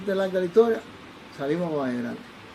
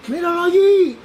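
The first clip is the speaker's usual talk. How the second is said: much higher and louder yell; shouting; 11 semitones higher, 10 dB louder, slower than usual. Retold or imitated. Drunk man spotting the doctor, shouting to his friend. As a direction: call out across the cafeteria. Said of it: "Pero gritaba".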